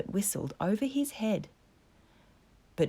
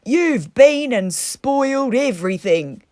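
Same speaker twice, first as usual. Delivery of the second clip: much higher, louder outburst; exasperated Toad; 6 semitones higher, 11 dB louder than usual. A narrator's reading. Toad scolding Mole for spoiling everything, exasperated. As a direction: blow up at him. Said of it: "cried Toad".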